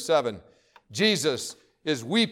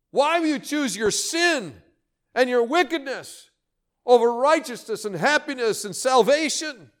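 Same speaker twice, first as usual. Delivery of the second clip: heartrending cry; 7 semitones higher than usual. Acted. God pleading with the stubborn, heartrending cry. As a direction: cry out each why in anguish. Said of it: "It's a heartrending cry".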